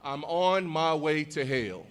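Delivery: slow "I'm on my way to hell" with "hell" as the word hell; mispronounced Hale. Retold or imitated. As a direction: repeat the innocent mistake, slowly. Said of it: "people laughing at me".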